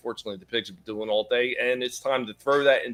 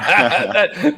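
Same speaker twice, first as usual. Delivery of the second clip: laughing; 8 semitones higher, 7 dB louder than usual. Real, not acted. Laughing at a joke.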